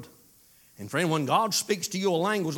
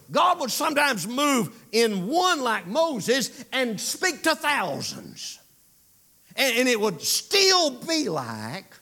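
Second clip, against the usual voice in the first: builds up higher and louder through the lines; rising preaching; 7 semitones higher, 4 dB louder than usual. Acted. Preacher stirring up the congregation, rising fervour.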